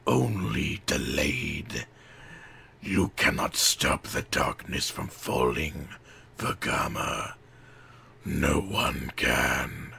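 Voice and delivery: very low, slow, whispered threat; Makuta's voice. A narrator's reading. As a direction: let the darkness speak. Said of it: "hissed Makuta".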